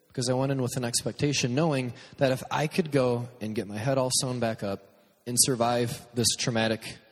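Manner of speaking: ordinary delivery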